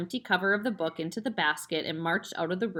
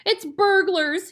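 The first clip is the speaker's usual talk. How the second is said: hammed-up hiss; 12 semitones higher, 8 dB louder, slower than usual. A narrator's reading. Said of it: "hissed the mother".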